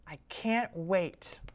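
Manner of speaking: plain reading voice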